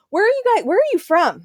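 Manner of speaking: lilting imitation of puzzled strangers; curious gym members. Voices curious gym members asking.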